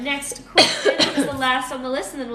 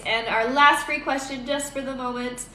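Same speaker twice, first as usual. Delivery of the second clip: ordinary delivery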